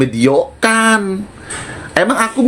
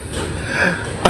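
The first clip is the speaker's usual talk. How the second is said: breathy laugh; 3 semitones higher, 9 dB quieter than usual. Real, not acted. Laughing at her own taunt.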